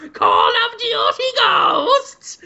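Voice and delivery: very high, slow screech. Acted. Host voices a figure taunting his netted captive, drawn-out screech. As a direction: drag out a demented high-pitched cry. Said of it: "[name]'s just going".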